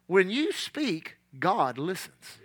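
low, slow and hushed, each word weighed; slow soft emphasis. Shown as a demonstration. Preacher hushing the congregation, slow and soft. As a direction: let the truth land gently. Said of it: "let me say that again".

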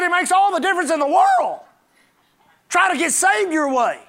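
high, emphatic retort from the pulpit; forceful preaching. Real, not acted. Preacher answering a man who doubts tithing, forceful preaching.

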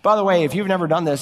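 normal talking voice

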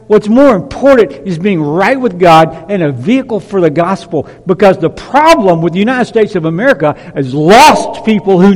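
rising pulpit thunder; fiery preaching. Acted. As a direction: preach it out with full conviction. Preaching at full force.

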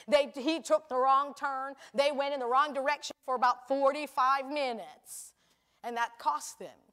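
usual speaking voice

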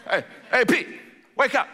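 short urgent nudges to wake him; lightly acted wake-up call; rousing a sleeper. Angel rousing the sleeping Peter.

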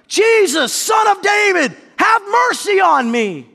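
high, drawn-out desperate plea; shouting. Acted. Blind beggar calling to Jesus from the roadside, shouting. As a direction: shout it slowly across a crowd. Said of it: "he began shouting".

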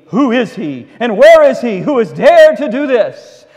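loud, outraged demand; furious king. Acted. King furious at hearing of the plot, shouting. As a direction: roar the question at the room.

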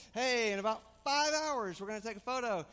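over-bright parent reminder, called out. Retold or imitated. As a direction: hype the kids up, bigger this time. Parent hyping kids for photo.

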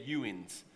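"youans" said low as a Blount County word; backwoods dialect. Shown as a demonstration. Pastor teasing Blount County locals, backwoods dialect. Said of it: "you're from the backwoods of Blount County".

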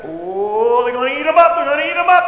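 loud, thrilled crowd call; excited cheering. Retold or imitated. Spectator cheering a great curve runner, loud excitement. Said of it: "you're like".

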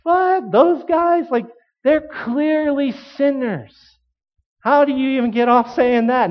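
high-pitched, incredulous scoff; can't believe what you just heard; amused disbelief. Acted. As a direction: act out baffled amusement, voice shooting up. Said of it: "maybe a little bit of amusement".

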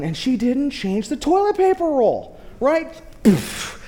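usual speaking voice

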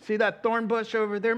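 loud, high jeering at the prisoner king; mocking taunt. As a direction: taunt him with rising glee. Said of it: "mocked him".

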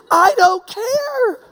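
very high and very slow, laughing through "I don't care"; mock dismissal dragged out through a laugh; laughing retort. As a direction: toss it off with a laugh. Blunt retort in the quarrel.

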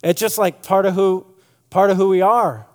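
ordinary talk

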